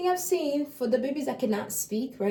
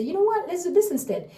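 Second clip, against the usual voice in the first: put-on higher, bright invitation to a child; cheerful redirect; 8 semitones higher than usual. Parent steering baby to a toy, cheerful coaxing. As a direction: lure the child to a new toy. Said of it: "You prompt them toward that and say".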